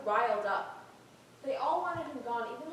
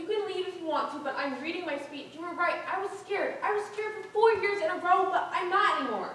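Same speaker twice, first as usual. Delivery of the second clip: raised, high, defiant voice; defiant outburst; 5 semitones higher, 7 dB louder than usual. Acted. Student refusing to be silenced by teacher, defiant and loud. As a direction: stand your ground, getting louder.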